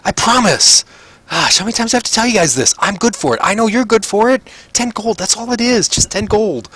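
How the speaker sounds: very high squeaky pleading voice; insistent gambler. Acted. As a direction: protest in a high, put-on voice.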